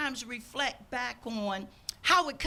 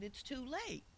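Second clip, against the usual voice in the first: softer, gently firm; calm, soft; 14 dB quieter than usual. Retold or imitated. Ticket agent tells the late customer softly, calm. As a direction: let him down softly but firmly. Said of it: "I very calmly said".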